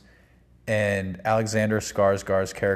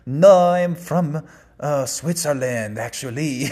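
imitating a bizarre foreign accent, much higher, louder and slow; mock the actor's odd Swiss-ish accent, stretching the words; hammed-up strange European accent, high and slow; bizarro accent; 5 semitones higher, 6 dB louder than usual. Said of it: "had this bizarro accent".

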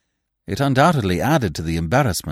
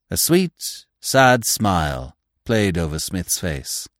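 plain reading voice

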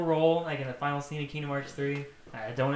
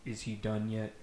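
a bit lower, softer villain voice; lightly lower villain; 4 semitones lower, 4 dB quieter than usual. Acted. Axel's cook asking about Sora soup, lightly lower. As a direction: ask hungrily in a low voice.

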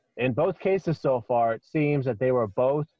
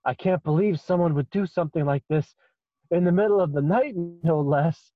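higher, distraught pleading voice; plead, shaken and indignant; upset satyr; 5 semitones higher than usual. Acted. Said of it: "The satyr is just like".

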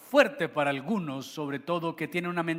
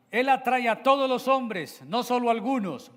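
mock objector; 8 semitones higher, 6 dB louder than usual. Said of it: "Algunos dirán".

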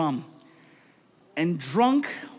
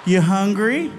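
put-on talking to a toddler; much slower than usual. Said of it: "talks to me like I'm two".

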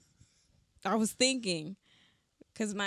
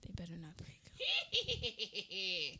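witch voice; 5 dB quieter, much slower than usual. Pretend. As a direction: croak it out like a cartoon witch. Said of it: "I like my witch voice".

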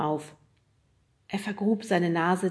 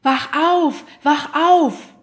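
loud, high-pitched yell to wake someone; urgent shout; 9 semitones higher, 12 dB louder, much slower than usual. A narrator's reading. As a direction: shout as if shaking a sleeper awake. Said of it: "schüttelte ihn an den Schultern und schrie". (laut und hoch geschrien)

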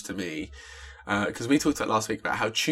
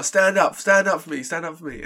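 much higher, loud, then fading on the last; loud calling out; 8 semitones higher, 4 dB louder than usual. Retold or imitated. Rapper urging his friend to stand up in the cinema, loud then fading. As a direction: call your friend to his feet, then lose steam. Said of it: "And he went".

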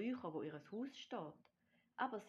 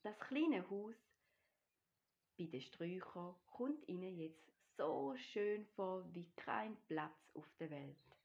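ordinary delivery, nothing marked

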